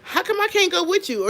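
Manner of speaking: wife begging husband to take her, mock whiny. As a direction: imitate a wife begging to come along. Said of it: "she'll say something like".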